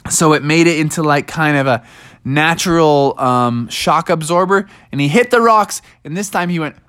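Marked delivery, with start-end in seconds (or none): none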